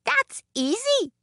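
very high, slow, piping voice; high-pitched carefree little woman; little woman, amused. A narrator's reading. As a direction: toss it off gleefully up high. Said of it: "laughed the little woman".

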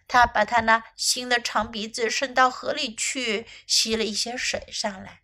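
ordinary delivery, nothing marked

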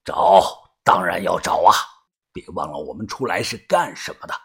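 put-on low, quiet older boss; gang leader. Boss reminding the crew why they came, low and quiet. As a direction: keep it low and measured, a leader in command.

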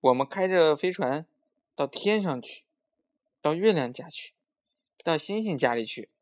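eager chiming in. A narrator's reading. Maomao cutting in to tell mother the plan, eager. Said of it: "毛毛抢着说".

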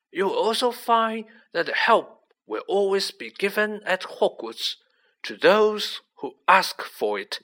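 calm, deliberate authority; slow and clear. A narrator's reading. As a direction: enunciate deliberately so none can miss it. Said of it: "speaking very slowly and clearly".

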